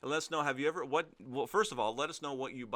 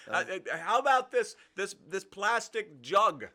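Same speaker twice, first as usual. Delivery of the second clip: pushy customer; 5 semitones higher, 6 dB louder than usual. Pretend. Customer role-play continues.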